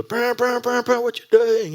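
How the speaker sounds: very high, loud mock honks and jeers; honking drivers. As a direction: ape the other drivers honking and yelling. Other drivers honk and yell.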